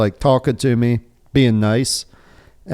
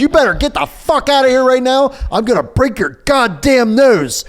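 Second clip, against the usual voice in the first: high, heated re-enacted threat; angry threat; 11 semitones higher than usual. Man retelling how he threatened an aggressive stranger, furious. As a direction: act out blowing up at someone.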